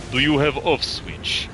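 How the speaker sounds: slow Russian accent, dropping the articles. Shown as a demonstration. Gamer shows friend he can still do an accent, Russian accent. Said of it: "still do have decent Russian accent".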